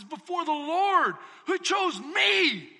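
loud, slow proclamation; voicing David. Preacher voicing David to his wife, loud slow proclamation. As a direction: proclaim it slowly and loudly. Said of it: "He says".

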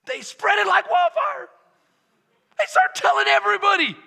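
high, loud and fast; excited shout. Real, not acted. Preacher describing the healed men, excited shout. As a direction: burst out with the news.

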